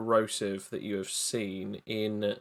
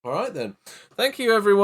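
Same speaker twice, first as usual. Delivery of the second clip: laughing; 10 semitones higher, 6 dB louder than usual. Real, not acted. Laughing while closing the show.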